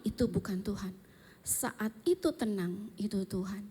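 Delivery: soft, settled, slowing down; hushed calm. Acted. Telling listeners God is in the calm, hushed. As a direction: let it fall into stillness.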